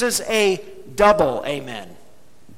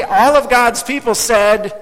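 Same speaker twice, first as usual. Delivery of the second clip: loud, drawn-out rallying cue; slow and punchy, louder, prompting a response; with oomph; 3 semitones higher, 7 dB louder than usual. Real, not acted. Preacher cueing the congregation to say amen, with oomph. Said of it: "with a little oomph".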